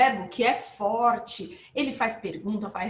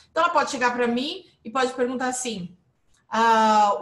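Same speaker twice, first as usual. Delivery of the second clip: ordinary talk, nothing marked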